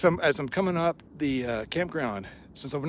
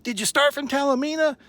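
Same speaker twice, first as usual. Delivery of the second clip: much higher, louder, thrilled questions; much higher excited camper voice; excited stranger; 12 semitones higher, 6 dB louder than usual. Retold or imitated. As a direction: gush like a delighted fan. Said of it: "he gets all excited".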